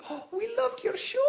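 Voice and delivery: very high put-on woman's voice; mock fangirl squeal; gushing woman fan. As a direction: gush in a high pitch.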